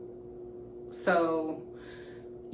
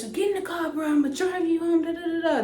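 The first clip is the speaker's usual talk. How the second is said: guy hustling friend off; 10 semitones higher than usual. Retold or imitated. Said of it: "he gets".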